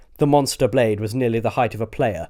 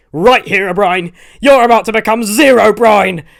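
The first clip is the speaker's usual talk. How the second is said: much higher, much louder battle roar; 10 semitones higher, 11 dB louder than usual. Acted. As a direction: roar a taunt at the foe. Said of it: "roared Milton".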